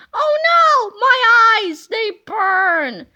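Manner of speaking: high, loud oh no my eyes; hammed-up horrified wailing; pained shriek. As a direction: cry out as if blinded. Teacher sees naked.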